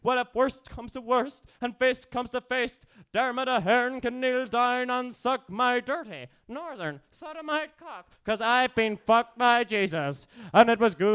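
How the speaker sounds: high, slow, defiant declamation; spoken-word performance. Acted. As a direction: stretch the words out toward a loud climax. Climax of poem.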